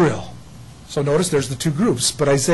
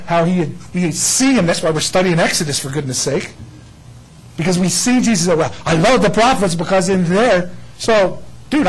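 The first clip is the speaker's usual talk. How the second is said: ordinary talk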